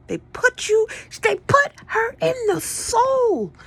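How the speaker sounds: very high, loud exclamation, stretched out; sudden leap into a loud, high squeal; high-pitched outburst. Acted. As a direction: squeal it loudly, drawing out the last words. Mock outburst over the soul.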